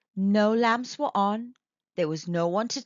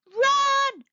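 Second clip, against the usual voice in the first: high, loud cry to flee; frightened shout; 6 dB louder than usual. Acted. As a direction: yell the warning, much higher and louder. Children flee a ghost.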